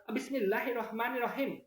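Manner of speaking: high-pitched Madurese take on Bismillah; Madurese accent. Shown as a demonstration. Host contrasts it with Arabic reading, high Madurese accent. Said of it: "Kalau logat Madura".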